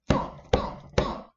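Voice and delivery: loud breathy thump thump thump; loud footsteps. Acted. Storyteller sounds the monster approaching, heavy thumping. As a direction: stomp out the giant's footsteps.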